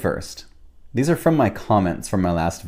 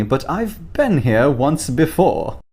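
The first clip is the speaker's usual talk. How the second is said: put-on English accent; 5 semitones higher, slower than usual. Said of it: "English accent".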